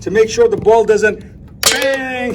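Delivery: put-on loud, lively talk for a little kid; reading to a toddler. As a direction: play it big for a toddler. Dad talking loudly to his toddler.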